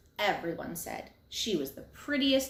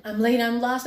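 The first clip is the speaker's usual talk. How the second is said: loud cry of late and lost; yelling; 4 semitones higher, 8 dB louder than usual. A narrator's reading. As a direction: call out in a panic.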